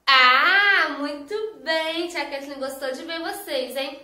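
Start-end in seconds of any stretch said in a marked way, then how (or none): 0.1-0.9 "A" shouted out much louder; teacher calling out the letter A to the kids; loud vowel call. Shown as a demonstration. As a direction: belt the vowel to the whole class. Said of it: "começam com a vogal".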